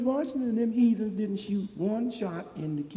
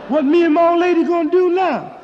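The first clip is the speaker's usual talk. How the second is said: high loud plea; 11 semitones higher, 12 dB louder than usual. Acted. Own pleading question acted.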